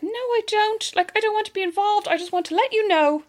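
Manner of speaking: high, prim refusal; high nervous refusal. Retold or imitated. Caller to the guards refusing to give her name, high and prim. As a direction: politely decline to be involved.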